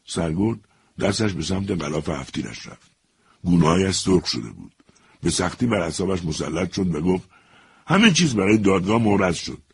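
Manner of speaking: lively radio storyteller voicing each character; story narration. A narrator's reading. Radio narrator telling a soldier's story, lively reading. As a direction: read it like a radio drama for listeners.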